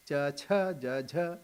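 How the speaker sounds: cha, cha, ja, jha, plain then aspirated in each pair; consonant variations. Shown as a demonstration. Swami showing difficult sounds, cha, cha, ja, jha in plain and breath-puffed pairs. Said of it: "little variations".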